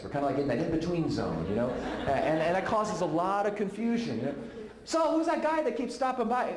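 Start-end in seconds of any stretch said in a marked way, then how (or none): 4.8-6.5 high, curious questioning voice; mock puzzled friend asking. Friend asking who the guy is, high and curious.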